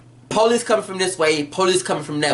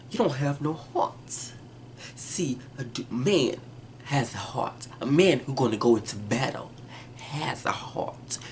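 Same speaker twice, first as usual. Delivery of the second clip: low cold contempt; 6 semitones lower, 9 dB quieter than usual. Retold or imitated. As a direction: belittle him in a cold near-whisper. Mother berates son coldly.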